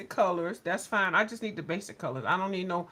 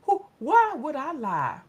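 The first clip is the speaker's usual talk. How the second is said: incredulous indignation; 8 semitones higher, 4 dB louder, slower than usual. Real, not acted. Indignant at accusation.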